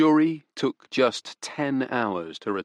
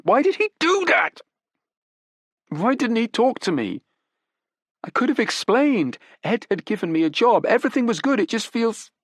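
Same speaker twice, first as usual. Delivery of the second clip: much higher, louder, near tears; anguished young woman; 14 semitones higher, 4 dB louder than usual. Acted. Daughter breaks down before the detectives, anguished. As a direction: cry out the questions in anguish.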